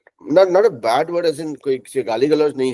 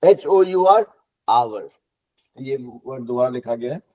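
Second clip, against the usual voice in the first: normal talking voice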